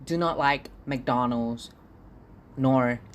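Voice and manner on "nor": normal talking voice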